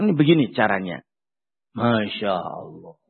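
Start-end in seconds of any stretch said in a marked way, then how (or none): 1.8-3.0 awed exclamation. Real, not acted.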